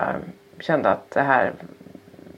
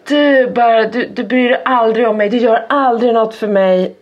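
higher, louder mock child complaint; retold tantrum, voice raised; imitated child's screaming; 4 semitones higher, 3 dB louder than usual. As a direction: wail it like an aggrieved kid. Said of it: "Står han och skriker".

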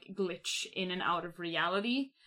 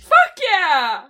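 loud, high whoop of delight; real elated yell; excited cheer; 9 semitones higher, 16 dB louder than usual. Real, not acted. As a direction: shout it out like a big win. Cheering the hand-holding reveal.

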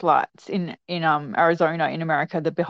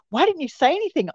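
high-pitched mock annoyance; imitating frustrated mum; 8 semitones higher than usual. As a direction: act out an exasperated mother's complaint. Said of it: "Mum got really frustrated and annoyed".

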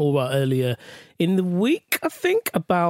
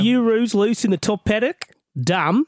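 higher, put-on Aussie twang; mock Australian reading; Australian accent; 6 semitones higher than usual. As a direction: list the slang in an imitation Australian accent. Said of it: "do this in an Australian accent".

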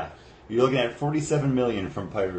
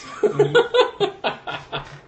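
high breathy laugh; 3 dB louder than usual. Real, not acted. Laughing at a joke.

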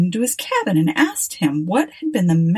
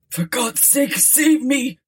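moaning in terror; 6 semitones higher, slower than usual. A narrator's reading. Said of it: "Jackson moaned in terror".

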